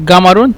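গামারুন with a hard g for q; teacher's g-variant of গামারুন; dialect pronunciation. Shown as a demonstration. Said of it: "উচ্চারণের ক্ষেত্রে বা কথা বলার ক্ষেত্রে এটা".

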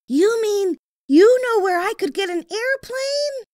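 put-on high, eager young boy; a young boy's high voice; little boy voice. A narrator's reading. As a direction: voice the small boy kindly. Boy's voice in story.

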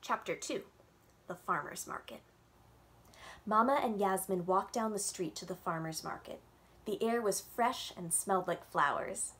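gentle storytime read-aloud with little voices; reading to children. A narrator's reading. Librarian reading a picture book to kids on Zoom, storytime voice.

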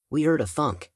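usual speaking voice

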